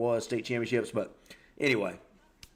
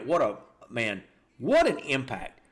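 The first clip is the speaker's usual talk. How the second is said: normal talking voice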